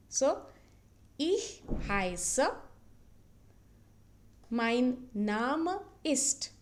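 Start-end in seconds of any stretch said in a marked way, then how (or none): 1.2-6.5 mein Name ist drawn out, Name as NAH-meh; slow model pronunciation. Shown as a demonstration. Teacher drilling mein Name ist very slowly for learners. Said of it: "But the pronunciation is different".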